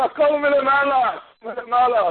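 slow, unbothered reply; slow high calm voice; calm acceptance. Retold or imitated. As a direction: take the blow with serenity. Rabbi calmly accepts being knocked down.

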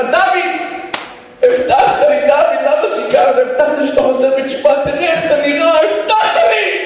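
high, pleading accusation; angry reproach. Retold or imitated. Father to drunk son who broke promise, angry reproach. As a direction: cry out, drawing out the last words.

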